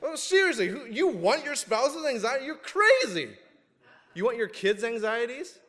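high, laughing incredulity; high laughing scoff; laughing disbelief. Real, not acted. Preacher mocks spouse's anxiety idea.

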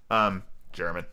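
usual speaking voice

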